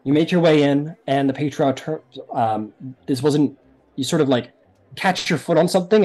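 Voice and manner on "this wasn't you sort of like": ordinary talk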